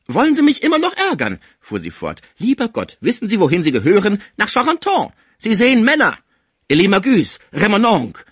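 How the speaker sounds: high, loud, fast scolding. A narrator's reading. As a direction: berate him as mad. Cibot scolds the sick man.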